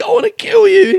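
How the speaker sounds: high, loud mock threat to the lady; mock shouted threat. Comedian to a lady in the crowd, hammed-up mock shout. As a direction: bark the line at her, hammed-up. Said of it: "He's like".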